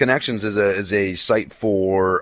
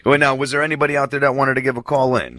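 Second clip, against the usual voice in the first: ordinary delivery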